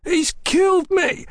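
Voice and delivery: very high, pained squawk; wounded ruffian squawking in panic. A narrator's reading. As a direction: squawk it high, as if just shot. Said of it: "the ruffian squawked".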